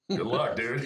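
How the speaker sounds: loud, high, laughing dismissal; laughing scoff. Real, not acted. SEAL veteran laughing off underprepared applicants, loud amused scoff.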